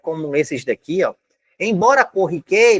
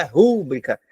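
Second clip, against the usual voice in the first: rúbrica stressed on RU, the first syllable; rúbrica said as RÚ-bri-ca; wrong syllable stressed; 4 semitones lower, slower than usual. Shown as a demonstration. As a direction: punch the RU of rúbrica. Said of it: "A sílaba tônica não é o ru".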